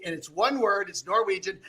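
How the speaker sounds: usual speaking voice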